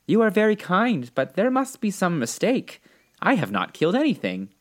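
put-on polite little girl, gently unsure; hesitant. A narrator's reading. Dorothy corrects the little old woman, hesitantly. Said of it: "so Dorothy said with hesitation".

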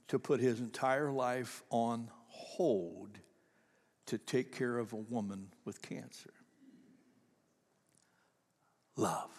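slow, intimate whispered close; hushed whisper. Real, not acted. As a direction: let it fall to a whisper. Closing reflection after the moving letter.

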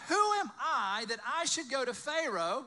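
loud, high, drawn-out disbelief; incredulous questioning. Acted. Moses asking God why he should go, loud and incredulous. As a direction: question it as if the task were absurd.

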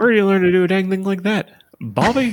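high, fast Texan scold; Hank Hill impression. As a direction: squeal Bobby like Hank. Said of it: "Bobby ... the word you gotta say to get into that impression".

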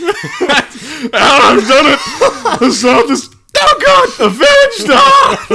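high, loud triumphant cry cracking with pain; hammy villain in pain. Retold or imitated. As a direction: shout victory through a broken leg. Acting out Booth after the leap.

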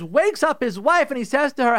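ordinary talk

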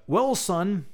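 lightly voiced Obama, slow fatherly cadence on room; Obama impression. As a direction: loose Obama-style delivery to a son. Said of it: "I don't know why I'm doing an Obama impression".

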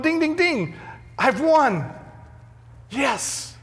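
high, excited game-show cheer; gleeful winner. Retold or imitated. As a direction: crow like you just hit the jackpot. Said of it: "he's going".

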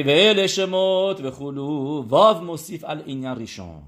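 reading the Hebrew verse aloud slowly. Shown as a demonstration. Teacher reciting the verse to the class, slow and deliberate.

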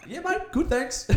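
a high giggly mock brush-off; high laughing reply. Hiker mimics the polite reply, high and laughing. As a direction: chirp it out between laughs. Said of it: "I'm like".

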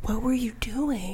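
slow, hushed, conspiratorial question; playful whisper. Real, not acted. Guest matching the co-host's hush, slow playful whisper. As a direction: whisper back, slowly.

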